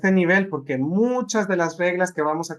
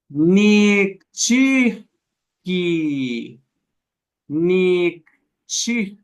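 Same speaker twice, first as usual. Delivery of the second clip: slow drill with a break; much slower than usual. Shown as a demonstration. Teacher shows the class the broken word at slow speed. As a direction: draw out Nikchihki slowly so the break is heard. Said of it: "Díganlo lento, díganlo rápido".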